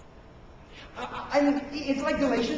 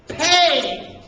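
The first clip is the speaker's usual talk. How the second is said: one word, loud and higher; loud emphasis; 5 semitones higher, 10 dB louder than usual. Real, not acted. Preacher hammering home the key word of the phrase, loud emphasis. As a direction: boom it out and stretch it.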